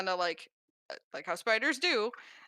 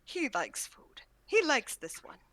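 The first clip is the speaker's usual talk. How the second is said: odd simple voice; 6 semitones higher, slower than usual. Acted. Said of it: "just pets it and goes".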